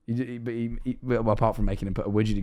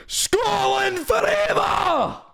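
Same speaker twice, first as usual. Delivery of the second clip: high, drawn-out rallying yell; mock patriotic war cry; much slower than usual. Mimicking offended Scottish viewers in chat, mock war cry. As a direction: bellow it like a fanatical Scottish supporter. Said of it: "all of a sudden".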